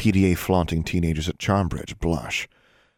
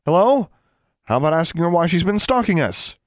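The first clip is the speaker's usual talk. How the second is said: high, loud, exasperated demand; indignant outburst; 12 semitones higher, 7 dB louder than usual. A narrator's reading. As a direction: butt in loudly, fed up. Alexandra angrily demands their attention.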